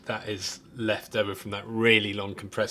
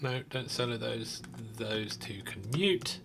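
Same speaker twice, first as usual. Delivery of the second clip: muttering quietly; 9 dB quieter than usual. Real, not acted. Muttering to himself while mixing.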